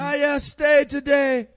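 very loud, slow, high Jamaican squawk; Jamaican parrot. Acted. Parrot hailing [name], high slow Jamaican squawk. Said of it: "Did he have a Jamaican accent?".

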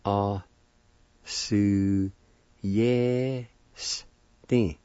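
"A, su, yes, ti" in four separate, drawn-out syllables. Shown as a demonstration. Teacher demonstrating the word for choose, "A, su, yes, ti" syllable by syllable. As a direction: sound out "A, su, yes, ti" with gaps. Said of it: "And it's pronounced like this".

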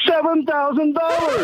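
high, drawn-out, elated reveal; thrilled announcement. Real, not acted. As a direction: proudly stretch out the big number. Caller proudly reveals his free silver.

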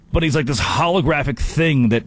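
normal talking voice

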